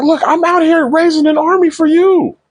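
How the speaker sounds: high-pitched imitation of an indignant plea; mock protest. Husband defends himself to the queen, high mock protest. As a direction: jump way up in pitch to plead your case.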